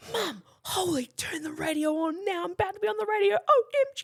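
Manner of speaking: can barely contain the thrill; giddy excitement. Retold or imitated. Texting mum about going on air, squealing excitement.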